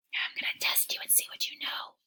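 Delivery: fast teasing whisper; playful whisper. Acted. Teacher whispering a playful test to kids. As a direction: whisper the challenge like a secret.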